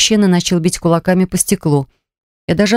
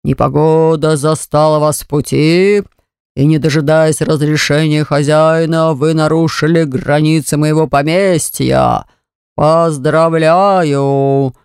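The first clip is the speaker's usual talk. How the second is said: sinister host voice; 3 semitones lower, slower than usual. Acted. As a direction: gloat over the trapped guests. The haunted portrait continues.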